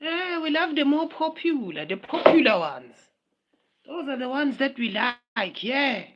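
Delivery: high, nasal-free mock trendy voice of the crowd; sing-song mocking of the popular crowd. Vlogger mocking trendy internet fans in a high mock voice.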